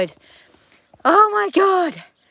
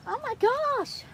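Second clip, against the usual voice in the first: ordinary talk, nothing marked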